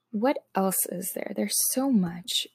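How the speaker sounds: hushed, near-whispered musing to oneself; thinking aloud. Real, not acted. Vlogger searching for the next topic, hushed thinking aloud. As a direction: murmur it softly under your breath.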